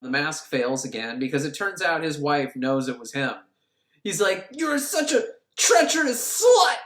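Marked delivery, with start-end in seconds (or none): 4.5-6.8 higher, louder mock accusation; hammed-up jealous husband fury; acted anger. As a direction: spit out an indignant mock tirade. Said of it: "He gets angry".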